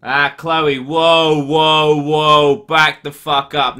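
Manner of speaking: loud, high, heated whoa; loud outburst. Real, not acted. Ranting at a viewer about baps, loud and heated.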